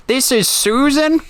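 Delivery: a puzzled granny questioning, pitch way up; old lady voice. Retold or imitated. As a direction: prank caller as an old lady, high and slow. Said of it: "like, an old lady voice".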